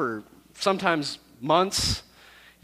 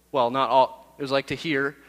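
ordinary talk, nothing marked

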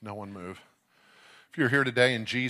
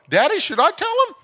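put-on high, loud child's skit voice; little girl; 15 dB louder than usual. Seven-year-old on her daddy's shoulders asking him, little girl voice. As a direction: pipe up loudly like an eager kid. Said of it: "She'd sit up there and go".

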